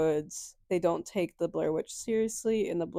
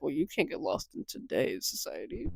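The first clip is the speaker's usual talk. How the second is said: mocking, softer; 5 semitones lower, 9 dB quieter than usual. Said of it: "she's over here like".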